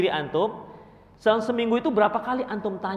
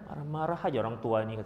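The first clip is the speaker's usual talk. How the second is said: muttered grudge; 7 semitones lower, 6 dB quieter than usual. Retold or imitated. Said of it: "dalam hati mendam".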